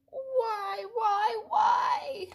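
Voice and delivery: high, loud, hammed-up wailing why; a cartoon pigeon's theatrical sob-shout; mock wail. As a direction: throw up your wings and cry out. Pigeon wails in protest.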